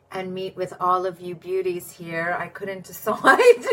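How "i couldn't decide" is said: laughing. Real, not acted. Admitting to viewers she couldn't choose, laughing. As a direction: laugh breaking through the words, louder.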